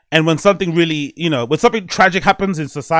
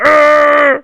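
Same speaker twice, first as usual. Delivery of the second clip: high, loud war cry; hammed-up charging yell; mock battle roar; 10 semitones higher, 6 dB louder than usual. Child fired up by pep talk, mock battle roar. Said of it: "give you a pep talk".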